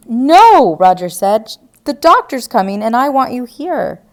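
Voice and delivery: firm loud no. Acted. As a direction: put your foot down. Roger orders Diane to stay.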